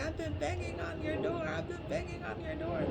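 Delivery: high, eerie voice of a spirit woman calling from outside; ancestor spirit voice. Retold or imitated. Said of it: "she's like".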